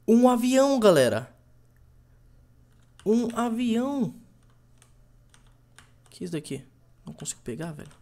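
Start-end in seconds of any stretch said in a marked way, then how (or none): 0.1-4.1 loud, thrilled discovery; excited shout. Real, not acted. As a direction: shout it out in amazement. Finds a plane in the game.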